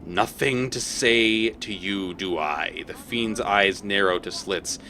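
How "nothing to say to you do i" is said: slow fiend voice, a touch of snarl; lightly voiced devil, slow and menacing. Devil answers the player, slow light snarl. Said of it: "The creature snarls".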